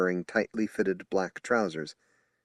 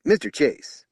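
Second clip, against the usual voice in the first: higher and louder, interrupting; strident; 8 semitones higher, 7 dB louder than usual. Acted. Spokesman interrupting to press the psychic, loud. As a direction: cut in firmly. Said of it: "Anderson said".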